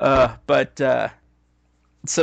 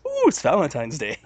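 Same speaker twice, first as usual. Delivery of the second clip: mock excited; 4 semitones higher, 5 dB louder than usual. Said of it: "You never hear a man go".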